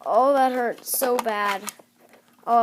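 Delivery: ordinary talk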